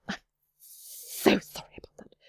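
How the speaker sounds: hushed, slow acted whisper; breath-only, apologetic murmur; whispered apology. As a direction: whisper it as an embarrassed aside. Ben apologises in whispered aside.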